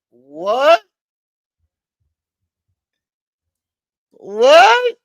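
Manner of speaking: high, rising disbelief; incredulous. Real, not acted. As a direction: ask it in rising, escalating disbelief. Disbelief at the battery excuse.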